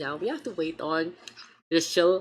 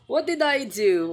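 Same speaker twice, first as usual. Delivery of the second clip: indignant; 9 semitones higher, 5 dB louder than usual. Pretend. Mock indignant protest.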